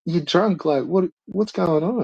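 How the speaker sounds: high, disbelieving police voice; accusing cop. Retold or imitated. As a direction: call him drunk, baffled. Cop accuses the man of being drunk.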